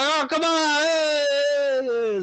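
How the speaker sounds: high Italian crowd protest; angry crowd complaining in a high Italian voice. Acted. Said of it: "the crowd's like".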